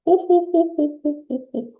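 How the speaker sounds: high, rounded ho-ho-hos; evenly spaced exercise ho-hos; laughing sounds. Shown as a demonstration. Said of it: "as you're making laughing sounds".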